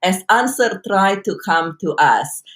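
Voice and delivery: ordinary talk, nothing marked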